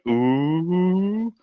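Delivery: high, drawn-out mocking ooh; a crowd of students teasing; class teasing ooh. Students teasing a classmate caught out, mocking ooh. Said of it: "everybody's literally going".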